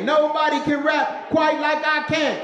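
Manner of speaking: high, shouted rapper boast. Retold or imitated. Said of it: "playing my best [name]".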